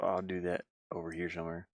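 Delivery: usual speaking voice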